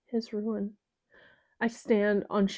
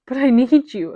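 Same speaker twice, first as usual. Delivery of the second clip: loud, higher, tearful begging; desperate sobbing plea; 5 semitones higher, 9 dB louder than usual. Acted. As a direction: cry it out through sobs. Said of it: "a sob racks my body".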